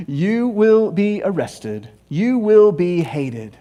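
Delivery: measured, drawn-out comic doom; slow, deliberate recap. Retold or imitated. Preacher recapping Jesus' warnings to disciples, slow and deliberate. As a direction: spell out each word slowly.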